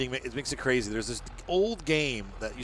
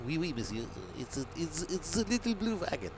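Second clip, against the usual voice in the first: high-pitched mock French accent; badly faked French accent, climbing higher; 6 semitones higher, 3 dB quieter than usual. Vlogger attempting French about his blue wagon, mock French accent. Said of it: "I can't do French".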